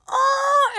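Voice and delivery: high, loud "oh" of adoration; overcome squeal. Retold or imitated. Fan reacting to the characters holding hands, squealing. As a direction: squeal as the heart melts. Reacting to tender hand moment.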